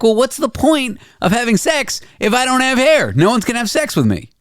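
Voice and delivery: high-pitched mock-exasperated protest; exasperated retort. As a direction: argue your case in a high, incredulous voice. Acts out his own exasperated reply.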